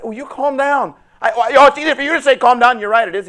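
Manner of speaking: loud high arguing; shouted exasperated exchange; heated back-and-forth. Acted. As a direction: snap back in frustration. Quarrel between God and person.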